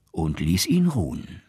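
ordinary delivery, nothing marked

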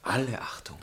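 dry breathy aside; whispered. Acted. Husband mutters about her novel, whispered. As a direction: murmur it under your breath, unimpressed.

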